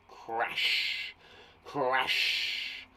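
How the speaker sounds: breathy whispered Crash, like a wave breaking. Shown as a demonstration. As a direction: hush out Crash like surf. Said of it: "Crashing waves".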